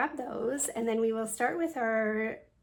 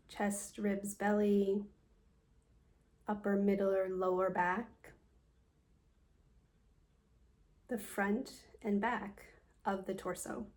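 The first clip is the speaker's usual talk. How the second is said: guided meditation. Acted. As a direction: guide them calmly through a body scan, leaving space after each phrase. Leads a yoga nidra meditation.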